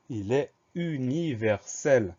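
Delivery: slow, separated syllables; syllable-by-syllable dictation. Shown as a demonstration. Teacher answering the grammar test question, slow syllable-by-syllable delivery. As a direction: spell it out by ear, one syllable at a time.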